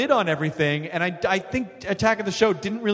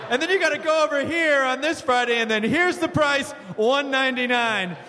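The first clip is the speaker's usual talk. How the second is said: high, bouncy sales pitch; salesman voice; 7 semitones higher than usual. Retold or imitated. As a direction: hype it like a store ad.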